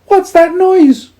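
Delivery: very high, tiny squeak of a voice; squeaky mouse. A narrator's reading. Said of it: "he squeaked".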